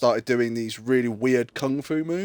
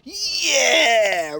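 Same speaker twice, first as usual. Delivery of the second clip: high, loud mock moan; 11 semitones higher, 4 dB louder than usual. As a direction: belt out a hammed-up yeah. Acted climax in quote.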